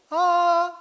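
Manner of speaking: high sung ah. Shown as a demonstration. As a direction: sing a high ah. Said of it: "o agudo". (ah cantado agudo)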